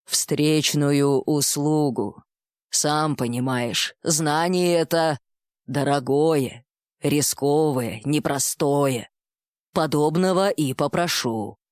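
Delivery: low, slow, weighty bull-man; slow minotaur voice. Acted. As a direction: drawl each word like a wise minotaur. Minotaur names his price.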